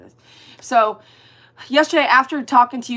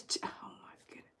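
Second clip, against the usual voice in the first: whisper; 21 dB quieter, faster than usual. Real, not acted. Surprised at livestream screen.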